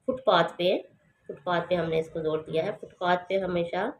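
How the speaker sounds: normal talking voice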